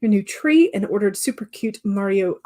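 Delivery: ordinary delivery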